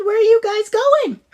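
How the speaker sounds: high, loud shouted question; calling out. A narrator's reading. Ziggy calls to friends flying past, high and loud. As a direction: call across to them.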